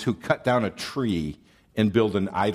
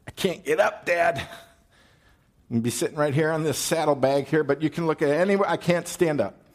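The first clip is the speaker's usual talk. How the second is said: higher, pleading daughter's voice; a daughter playing innocent to her father, higher pitched; hammed-up Rachel pleading; 4 semitones higher than usual. Rachel voiced, hiding idols.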